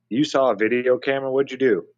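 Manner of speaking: ordinary delivery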